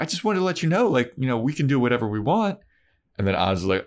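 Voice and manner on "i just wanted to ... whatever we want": a lightly voiced teasing girlfriend; coy Willow voice. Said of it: "She's like".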